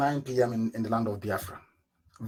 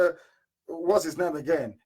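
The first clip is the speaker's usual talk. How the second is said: ordinary delivery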